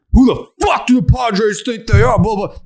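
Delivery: harsh angry misreading; how fans heard it, aggressive; mock hostile reading. Shown as a demonstration. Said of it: "That's not what, how I said it".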